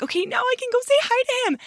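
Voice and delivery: high and quick, eager; psyching herself up to go. Retold or imitated. Finally getting her chance.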